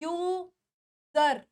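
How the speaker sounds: "यूजर" slowly drawn out as yoo, zer; syllable by syllable. Shown as a demonstration. Teacher introduces the word यूजर, slow syllables. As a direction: break यूजर into two slow parts. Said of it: "लेकिन ये word है".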